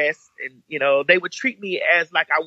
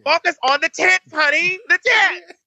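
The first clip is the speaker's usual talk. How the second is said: high mocking voice; 13 semitones higher, 3 dB louder than usual.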